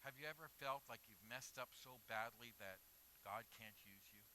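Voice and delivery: ordinary talk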